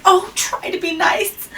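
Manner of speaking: high pleading voice; whimpered plea. Acted. A touch of the lion whimpering a plea to the mouse. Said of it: "he whimpered".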